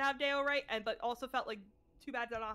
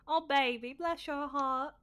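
southern accent warm-up phrase; slower than usual. Shown as a demonstration. Finding the accent with a stock phrase, southern accent. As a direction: put on a Southerner's lilt. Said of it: "That's not how I do it. I always do".

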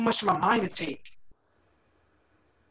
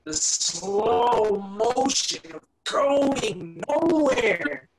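very slow, drawn-out words; long, lingering vowels that climb at the end; slow motion; 3 semitones higher, much slower than usual. Acted. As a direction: stretch every word as if in slow motion. Poetic line acting out slow motion.

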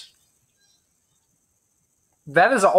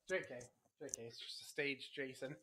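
quiet aside; 7 semitones lower, 27 dB quieter than usual. Real, not acted. Low aside after the outburst.

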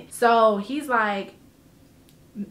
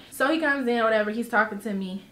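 normal talking voice

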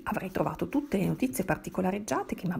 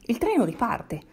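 louder, raised call; narrated shout; 3 semitones higher, 6 dB louder than usual. A narrator's reading. Bystander warning a dazed passenger about the train, shouting. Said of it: "gridandomi più forte".

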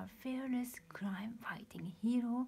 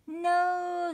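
high, loud scream of protest; 9 semitones higher, 10 dB louder than usual. Acted. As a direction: cry out in horror. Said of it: "screamed the veggies".

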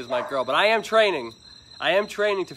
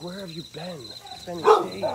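voicing the dog; 5 semitones higher than usual. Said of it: "She's like".

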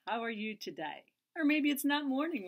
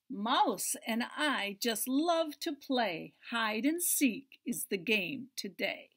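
slow, louder read-aloud voice; reading to children; 5 dB louder, slower than usual. Acted. Storyteller reading to children, slow and clear. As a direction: read it slow and bright for kids.

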